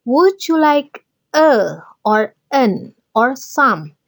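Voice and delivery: very slow teacher model of the pattern. Shown as a demonstration. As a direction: lay out each word slowly for the class. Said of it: "You can use this word". (pola kalimat diucapkan sangat pelan)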